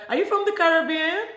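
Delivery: lightly put-on high voice of someone guessing; curious stranger. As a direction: imitate a curious stranger asking. Imitates strangers guessing her origin.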